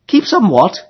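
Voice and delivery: Emily calling. A narrator's reading. Emily asking the rower what he wants, high girlish call. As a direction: ask brightly like a young girl.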